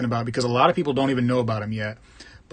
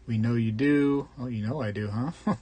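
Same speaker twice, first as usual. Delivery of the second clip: ordinary delivery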